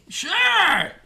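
a high squeaky fan saying yes; mock eager yes. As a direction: answer like a thrilled fan. Imagined reply from the fan.

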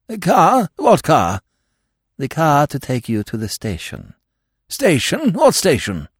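high, startled queries; baffled questions. Acted. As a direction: ask in bewilderment.

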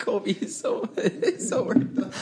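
breathless laughing whisper. Real, not acted. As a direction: wheeze the words through laughter. Laughing at a friend.

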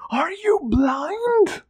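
high, slow, crying shriek; a broken king shrieks at them; wailing shriek of disbelief. A narrator's reading. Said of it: "Calor shrieked".

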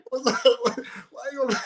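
high, breathy laugh through the words. Real, not acted. Player laughing at his own bench memory. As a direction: laugh as you try to finish.

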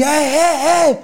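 high gloating eh eh eh; triumphant whoop. Retold or imitated. Boy sliding into home taunting the moms, gloating whoop. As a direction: crow in triumph at the rivals. Celebrating his slide home.

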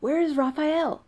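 high, loud, drawn-out urgent question; panicked demand. A narrator's reading. Zachary searching the camp for Raphael, panicked.

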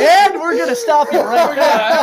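laughing through the words, slow; cracking up while cutting him off; slow laughing interruption. Real, not acted. Laughing shutdown of rude question.

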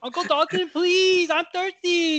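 put-on high, whiny begging child; high pleading whine. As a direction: beg like a needy little kid. Teen begs uncle for lemonade.

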